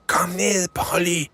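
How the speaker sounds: high, urgent scolding; boy hissing at the elf to get down; hissed command. Acted. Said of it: "hvæser Frederik".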